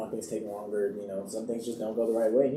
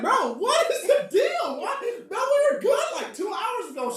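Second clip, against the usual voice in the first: exasperated disbelief; slower than usual. Retold or imitated. Actor retelling his baffled outburst at co-star, high exasperated cry. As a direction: act baffled and fed up, voice jumping up.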